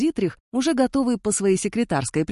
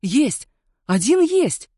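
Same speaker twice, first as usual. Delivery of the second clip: high, loud triumphant cry; excited exclamation; 7 semitones higher, 4 dB louder, much slower than usual. A narrator's reading. Ditrich, finding the record in the folder, cries out excitedly. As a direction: burst out with the find after tense silence. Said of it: "воскликнул".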